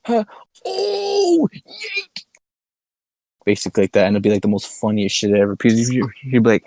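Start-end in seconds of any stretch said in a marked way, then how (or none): 0.6-2.2 very high, drawn-out "oh" into a squeal; hammed-up high-pitched whoop; mock screech. Friend clowning about the recorded clip, mock screech. Acts out over-the-top reaction.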